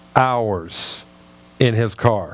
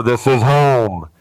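booming audible God; 5 semitones lower, slower than usual. Retold or imitated. The audible God voice the pastor did not hear, slow and booming. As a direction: announce "This is home" like a voice from heaven, slow and lower. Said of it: "he didn't go".

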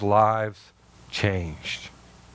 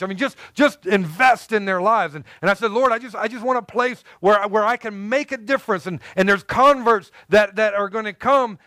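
higher, heightened preacher's voice; preaching; 8 semitones higher than usual. Acted. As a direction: preach it to the congregation. Preaching at full force.

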